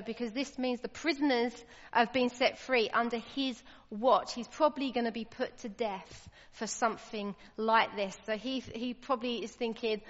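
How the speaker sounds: ordinary talk, nothing marked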